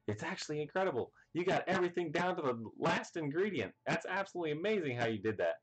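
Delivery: high, fast praise; mock amazed. The chefs praising Sanji's cake, fast and high.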